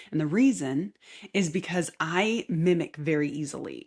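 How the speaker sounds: usual speaking voice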